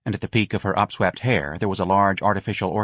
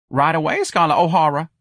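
high, louder sarcastic reply; cheeky, raised-pitch comeback; mocking janitor; 8 semitones higher, 4 dB louder than usual. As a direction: answer the boss with mock obedience. Janitor mocks the boss's order.

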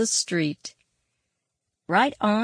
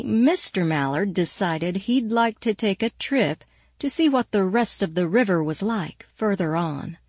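usual speaking voice

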